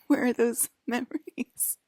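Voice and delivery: high, slow laughing disbelief; incredulous giggling question. Real, not acted. Laughing disbelief at own memories.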